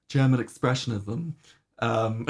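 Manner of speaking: ordinary delivery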